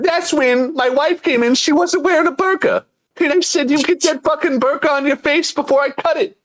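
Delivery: high-pitched comic rant impression; Bill Burr. Comedian ranting at his wife, high impression voice. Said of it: "progressively starting to sound like Bill Burr".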